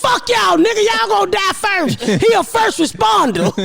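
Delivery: high, angry comeback; retold argument. Mother yelling back at the coworkers, high angry retort. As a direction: snap back at them high and hot.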